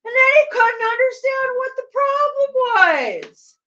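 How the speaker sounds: high, loud complaint; exasperated yelling. Real, not acted. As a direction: holler your disbelief at the player. Exasperated at the player.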